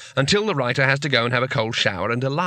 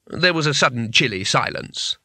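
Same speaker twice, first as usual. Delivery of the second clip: plain reading voice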